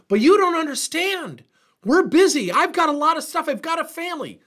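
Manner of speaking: high, loud whiny employee protest; complaining employee. Retold or imitated. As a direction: mimic a busy worker making excuses. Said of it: "I can already hear employees saying".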